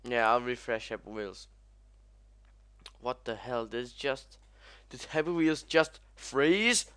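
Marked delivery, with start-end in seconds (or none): 4.9-6.9 higher, louder, FREEZE shooting up; mad disbelief. Real, not acted. Gamer mad at the frozen game, incredulous outburst. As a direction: demand it in outraged disbelief. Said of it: "I'm mad now".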